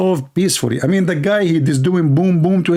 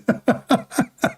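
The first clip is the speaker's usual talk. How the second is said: airy burst of high laughter; breathy laugh; 9 semitones higher, 3 dB quieter than usual. Real, not acted. Mocking the married wife story, airy laugh. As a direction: break into a breathless high giggle.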